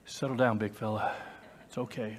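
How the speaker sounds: low, soft calming voice; calm a scared animal gently; soothing. Pretend. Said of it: "Let me soothe him".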